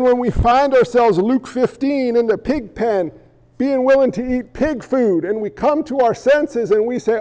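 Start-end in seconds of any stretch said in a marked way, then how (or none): none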